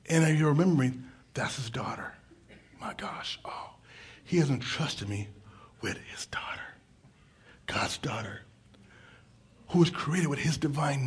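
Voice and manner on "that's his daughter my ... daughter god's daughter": whispering. Real, not acted. Awed aside about God's daughter.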